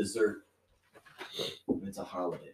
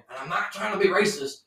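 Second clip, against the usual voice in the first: mocking tone; 9 semitones higher than usual. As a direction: sneer the excuse in a higher pitch. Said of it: "when they're saying".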